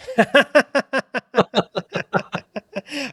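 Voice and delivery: high burst of laughter; big laugh. Real, not acted. Storyteller laughing at the partner reveal. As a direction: crack up at the reveal.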